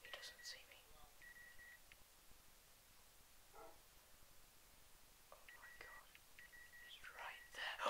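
tense hiding whisper; hushed frightened whisper. Real, not acted. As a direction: whisper so it won't find you. Hiding from the mob.

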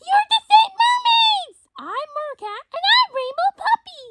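high, squealing cartoon voices; high-pitched playful puppy; excited toy characters. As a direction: introduce yourselves as thrilled little toys. Voices toys introducing themselves.

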